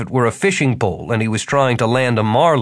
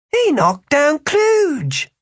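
very high, slow, stretched-out amazement; awed; much slower than usual. A narrator's reading. Small gang member seeing the leader knocked down, awed. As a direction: marvel in a high, drawn-out thug voice. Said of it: "in an awed voice".

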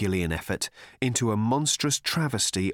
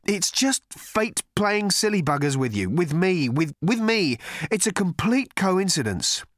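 flustered Arthur voice; 9 semitones higher, 3 dB louder than usual. Acted. Arthur pleading with creature.

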